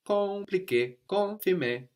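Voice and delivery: "Compliqué, confirmé" high and bright, m and n swallowed; nasal model. Shown as a demonstration. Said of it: "The M is kind of swallowed into the vowel".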